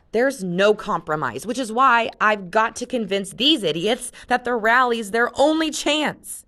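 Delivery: high and loud irritated insistence; irritated, impassioned. Acted. Jen insists to Luke there is no compromise, loud and irritated. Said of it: "Jen said irritably".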